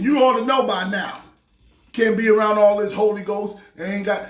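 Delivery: normal talking voice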